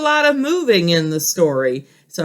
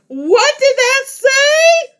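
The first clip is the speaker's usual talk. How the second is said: very high, slow, louder alarmed cry; excited Grover; 4 dB louder, much slower than usual. Acted. Grover reading the warning aloud, high panicked cry. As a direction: shriek the question in a panic. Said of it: "saying it really loud".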